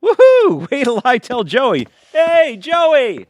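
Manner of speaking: cheer, then call across the yard; joyful shout. Acted. Husband celebrates, calls friend.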